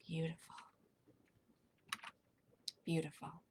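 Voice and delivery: soft, calm breathing guidance that fades toward a whisper; guided meditation. Acted.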